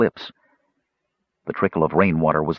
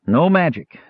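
louder firm refusal, stress on "No"; stubborn; 7 semitones higher, 4 dB louder than usual. A narrator's reading. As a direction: refuse flatly, "No" punched. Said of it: "Sturm said stubbornly".

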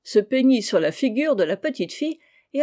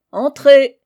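much louder, higher "come in" call; shouted call; 6 semitones higher, 15 dB louder than usual. A narrator's reading. Two young men answer a knock together, shouting. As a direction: call out through a closed door. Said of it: "crièrent à la fois les deux jeunes gens".

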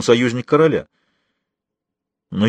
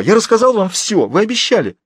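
high, desperate protest; crying out; 10 semitones higher, 4 dB louder than usual. A narrator's reading. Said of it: "вскричал Фулуа". (отчаянный протест на высоких тонах)

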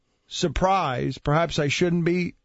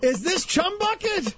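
high squeaky question; 13 semitones higher than usual. Acted. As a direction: voice pushed way up. Host asks in high voice.

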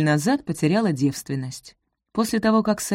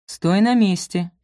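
ordinary delivery, nothing marked

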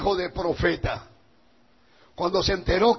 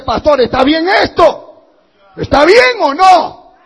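loud, higher, insistent question; sheep challenging pastor; 7 semitones higher, 12 dB louder than usual. Acted. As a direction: demand an answer from the pastor, loudly.

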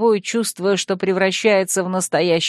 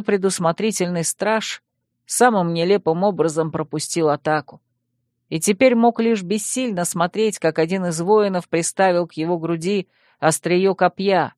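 ordinary delivery, nothing marked

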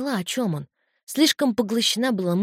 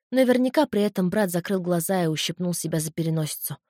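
ordinary delivery, nothing marked

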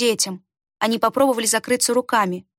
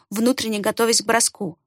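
plain reading voice

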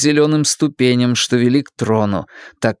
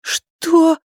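put-on high, scared child voice; a scared boy asking; frightened boy. Frightened Jake.

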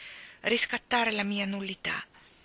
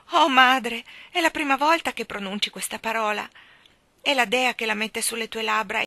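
Ermanno overjoyed; 2 semitones higher, 7 dB louder than usual. Acted. Ermanno moved, exalted.

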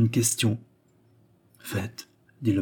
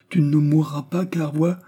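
higher, urgent reassurance; tender outburst; 6 semitones higher than usual. A narrator's reading. Marquis promising the woman a cure, tender outburst. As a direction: insist with sudden feeling. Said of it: "avec une subite explosion de tendresse".

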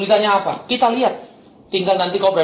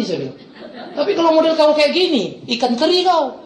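mocking lecture; 10 semitones higher than usual. Pastor lectures thug mockingly.

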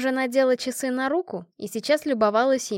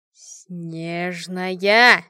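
drawn-out menace swelling louder; menacing; 3 semitones lower, 5 dB louder than usual. A narrator's reading. Вита rounds on her forgetful friend, menacing. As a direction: stretch the name into a threat. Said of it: "грозно сказала Вита".

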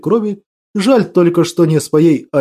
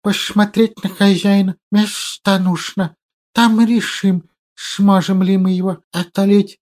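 lisping creature voice; 2 semitones higher than usual. Acted. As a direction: hiss through the teeth. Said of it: "даже мысленно шепелявить".

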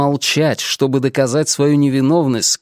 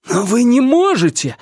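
exclaiming; 10 semitones higher than usual. Acted. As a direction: cry out in protest. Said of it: "воскликнул Ренар".

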